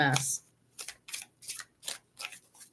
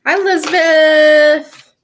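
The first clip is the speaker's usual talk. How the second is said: drawn-out, much higher and much louder hello; excited greeting; 9 semitones higher, 9 dB louder, slower than usual. Real, not acted. Happily greeting a viewer who just joined the stream, sung-out hello. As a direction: call out her name with delight, slowly.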